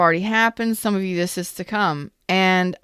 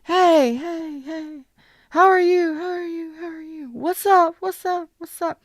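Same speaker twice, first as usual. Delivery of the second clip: calling down from turrets; 10 semitones higher than usual. Pretend. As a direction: call down from the castle wall. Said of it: "talk to 'em from the top of the par-, the, the turrets".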